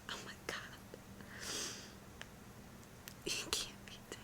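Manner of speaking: tiny, grief-struck whisper; about to cry. Real, not acted. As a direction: whisper it in disbelief, near tears. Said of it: "I'm gonna cry".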